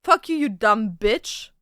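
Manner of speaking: ordinary talk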